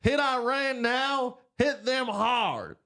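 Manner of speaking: high, slow, strained senator voice pushing for war; Lindsey Graham impression. Podcast host mocking a senator's war call, in a high, slow put-on voice. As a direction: drag out each word like a hawkish old senator. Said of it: "Lindsey Graham".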